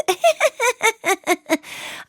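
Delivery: high burst of real laughter. Real, not acted. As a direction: cackle at the parody. Laughs at own parody.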